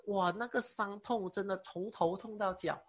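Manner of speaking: ordinary delivery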